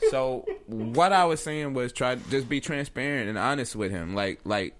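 normal talking voice

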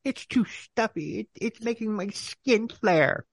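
slow, high put-on whine; hammed-up slow griping; mock complainer. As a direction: drag out a high-pitched fussy complaint. Imitating Marge complaining, drawn out.

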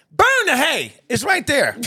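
ordinary delivery

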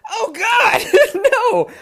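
high, loud horrified cry with a laugh breaking in; half-laughing scream of horror; horrified shriek. Retold or imitated. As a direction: shriek in disgusted horror at the screen. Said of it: "sitting at the computer going".

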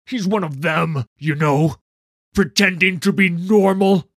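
loud, high-pitched angry sneer. Acted. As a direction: spit it out with open contempt. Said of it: "he sneered".

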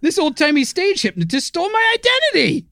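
high, startled outrage; mock outrage. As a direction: act shocked and indignant. Outraged at the name theft.

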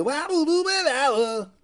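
shrieked rock scat; high loud scat. Pretend. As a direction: wail a nonsense riff. Scat after song.

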